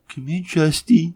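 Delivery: put-on high, slow coaxing baby talk; dog-calling voice. Podcaster pretending to call dog Justy, high coaxing voice.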